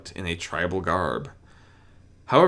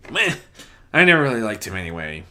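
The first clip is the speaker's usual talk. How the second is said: dry; 3 semitones higher, 5 dB louder, faster than usual. A narrator's reading. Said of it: "he concluded dryly".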